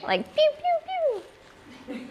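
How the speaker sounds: high, light pew-pew like a toy laser gun. Retold or imitated. Imitating the cardinal call to the bird group, a high laser pew. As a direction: chirp it like a little laser blast. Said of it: "the little laser guns".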